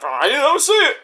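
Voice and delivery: a high, innocent mock referee; mock ref. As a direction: play dumb like the ref. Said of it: "Ref's like".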